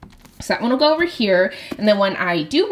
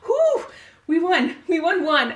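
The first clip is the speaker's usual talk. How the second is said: relieved win; 7 semitones higher than usual. Real, not acted. Relief after winning card.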